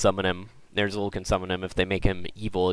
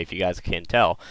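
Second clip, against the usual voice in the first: usual speaking voice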